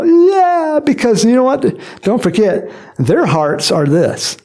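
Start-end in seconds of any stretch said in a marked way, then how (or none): 0.0-0.8 high, louder crowd cheer; shouting yeah. Retold or imitated. People cheering the king, high loud yeah. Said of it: "They're shouting out".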